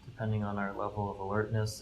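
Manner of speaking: ordinary talk, nothing marked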